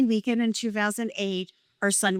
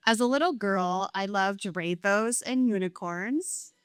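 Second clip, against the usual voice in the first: usual speaking voice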